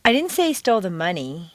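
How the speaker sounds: "money" emphasized at the end; stress on MONEY. Shown as a demonstration. Teacher indicating something besides money was stolen, stress on "money". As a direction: punch "money" to imply another item. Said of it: "he stole something besides money".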